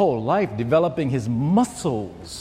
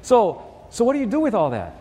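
impressed talk show host, pitched up; high-pitched imitation of an admiring host; Merv Griffin; 7 semitones higher than usual. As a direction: gush with higher-pitched approval, then ease into the question. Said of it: "And Merv goes".

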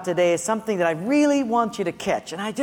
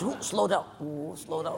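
hushed warning; 5 semitones lower, 10 dB quieter than usual. Retold or imitated. Sneaking out on gravel.

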